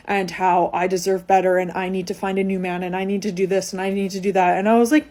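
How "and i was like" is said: ordinary talk, nothing marked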